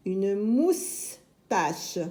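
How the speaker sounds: very slow "moustache", split in two; drawn-out sounding-out of "moustache" for beginners; syllable by syllable. Shown as a demonstration. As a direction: hold "mous", pause, then "tache".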